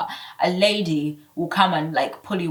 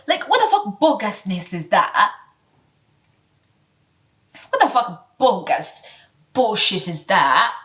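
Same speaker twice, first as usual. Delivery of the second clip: normal talking voice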